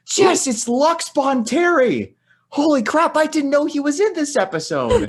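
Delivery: high, loud, slow excited squeal. Retold or imitated. Telling his wife Jess about a Star Wars cameo, giddy squeal. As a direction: gush over a minor character, half laughing.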